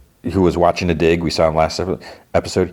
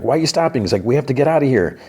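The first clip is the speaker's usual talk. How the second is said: higher-pitched urgent protest; mildly panicked retelling, raised pitch; retold alarm; 6 semitones higher, faster than usual. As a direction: mimic someone urging escape. Retold frightened flight from creature.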